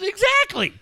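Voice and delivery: high, loud retold exclamation; emphatic agreement. Ex-wife agreeing in a retold exchange, high and emphatic. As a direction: pitch it way up, imitating her emphatic agreement.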